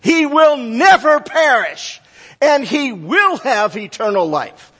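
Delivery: high emphatic stress on "never". Retold or imitated. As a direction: proclaim it as Jesus' firm promise, "never" up high. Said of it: "He emphatically says".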